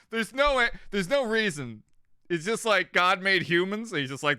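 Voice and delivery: ordinary talk, nothing marked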